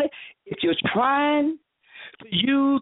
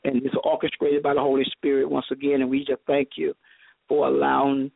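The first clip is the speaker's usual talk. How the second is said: low, level prayerful thanks; closing blessing; 8 semitones lower than usual. Real, not acted. Preacher thanking her hosts for having her, prayerful blessing. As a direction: settle into a subdued benediction.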